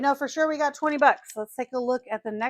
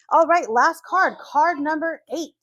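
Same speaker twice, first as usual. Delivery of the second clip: higher and much louder, building up the last card; excited; 6 semitones higher, 8 dB louder than usual. Real, not acted. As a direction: pump it up for the finale. Announcing the final scratch card.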